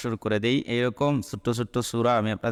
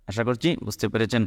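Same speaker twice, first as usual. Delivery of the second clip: usual speaking voice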